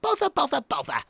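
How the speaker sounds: high, rapid-fire grumbling; baby owl in a huff; frustrated. A narrator's reading. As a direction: repeat it crossly in a little voice.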